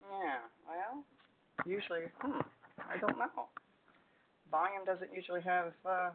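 faint, hard to hear on the line; very quiet. Real, not acted. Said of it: "It's just very quiet".

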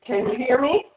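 muffled, hollow phone line; echoey, far-off call audio; voice in a tunnel. Real, not acted. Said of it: "It sounds like you're in a tunnel".

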